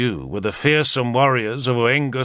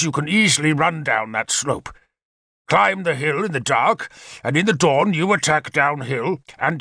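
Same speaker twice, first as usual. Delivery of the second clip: lightly raised, keen war-leader voice; Arthur, eager commander; 5 semitones higher than usual. Acted. Arthur briefs his captains on the attack, eager commander voice. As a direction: lay out the plan like a confident general. Arthur explains battle plan.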